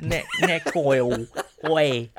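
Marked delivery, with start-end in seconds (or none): 1.7-2.1 a bit higher, cheeky London geezer; mock cockney geezer. Teasing his co-host with a higher geezer voice. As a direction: play the pub geezer ordering a pint. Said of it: "Geezer, son".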